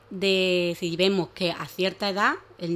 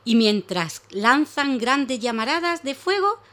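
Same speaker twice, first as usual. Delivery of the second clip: story narration; 3 semitones higher, 5 dB louder, slower than usual. Acted. Reads a story to children.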